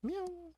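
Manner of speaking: tiny, high, soft meow; cat meow. Acted. Player voices the hungry alley cat, tiny high meow. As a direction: mew like a small hungry cat.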